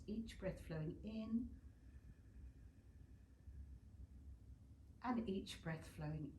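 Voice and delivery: calm, unhurried cues for each breath; guided meditation. Acted. Yoga teacher guiding students through breathing, calm meditation voice. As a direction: talk the class through breathing and alignment.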